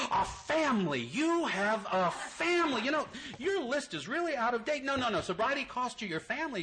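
high, overacted God; mock surprised God. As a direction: act out a teasing God, going high. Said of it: "God's answer".

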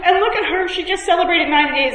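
high weepy mimicry with a laugh; mock crying. Imitating a crying friend moved by a newcomer's milestone, mock tearful. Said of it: "and she's crying".

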